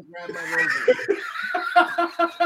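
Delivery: high-pitched burst of laughter; laughing. Real, not acted. Friend cracking up as another gets worked up, high laughter.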